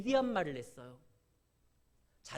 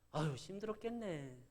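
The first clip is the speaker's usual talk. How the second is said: unhurried sympathy; 9 dB quieter than usual. Retold or imitated. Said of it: "우리는 왜 이렇게 느리냐면".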